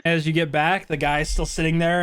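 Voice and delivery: ordinary talk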